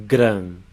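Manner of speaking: gran said with a nasal vowel and a lightly trilled R; nasal Portuguese vowel. Shown as a demonstration. Teacher isolates the first syllable of grande for students. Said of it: "The sound of that A is E".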